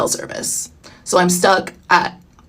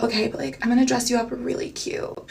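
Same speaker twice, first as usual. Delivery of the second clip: Dakota's soft voice; 2 semitones higher, 8 dB quieter than usual. Retold or imitated. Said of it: "that's how she talks".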